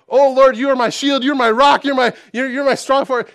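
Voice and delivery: high, loud shouted prayer like a frightened roommate; yelled prayer. Retold or imitated. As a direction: cry it out to the Lord, loud and high. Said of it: "He'd start yelling it".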